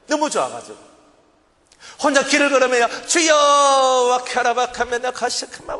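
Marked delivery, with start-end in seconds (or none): 3.1-5.7 raised call then slow babbled tongues; speaking in tongues. Retold or imitated. Walking alone praying in tongues, raised call then slow babble. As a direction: pray it out in tongues. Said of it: "방언이 막 나오기 시작하는".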